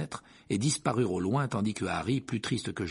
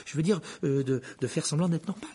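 higher, stumbling promise; hurried; 7 semitones higher, 3 dB louder than usual. A narrator's reading. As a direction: blurt it and scramble to correct yourself. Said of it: "reprit précipitamment Harry".

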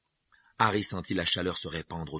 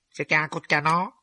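put-on high, loud dopey boy voice; dim boy Dudley; 9 semitones higher, 8 dB louder than usual. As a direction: ask it like a dull startled kid. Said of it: "demanda-t-il bêtement". (voix de garçon niais)

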